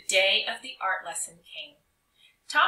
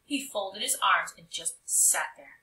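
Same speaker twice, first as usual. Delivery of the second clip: plain reading voice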